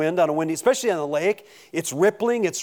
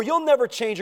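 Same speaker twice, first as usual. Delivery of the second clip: taunting jeer; 8 semitones higher than usual. Retold or imitated. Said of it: "the taunts of your giants".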